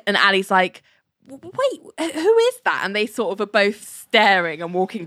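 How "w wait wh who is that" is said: high, stammered disbelief; mock a baffled double-take, tripping on the first sounds; stammering surprise. Ali spots a strange man with Elaine, stammering surprise.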